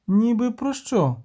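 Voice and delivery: put-on high, slow child's question; little girl. A narrator's reading. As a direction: ask slowly in a high girl's voice. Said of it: "захована в листі дівчинка".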